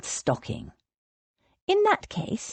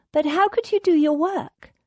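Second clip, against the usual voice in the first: much higher, eager questioning voice; curious Rose; 10 semitones higher than usual. A narrator's reading. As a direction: press him with a question.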